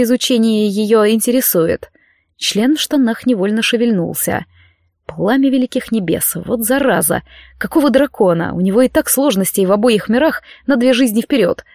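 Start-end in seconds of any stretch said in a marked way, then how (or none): none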